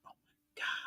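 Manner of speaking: breathless whisper, almost no voice; hushed swoon over the cookies; whispered oh my God. Real, not acted. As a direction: breathe it out as a secret thrill.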